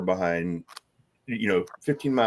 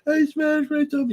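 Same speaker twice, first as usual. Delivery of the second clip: put-on whimpering child. A hurt boy telling his grandfather, high childish whimper. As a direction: whimper it like a hurt five-year-old.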